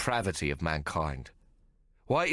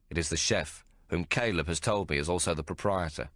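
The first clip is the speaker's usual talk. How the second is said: plain reading voice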